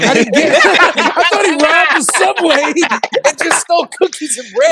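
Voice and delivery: laughing. Real, not acted. Telling friends about the stolen cookies, laughing. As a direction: crack up while telling it.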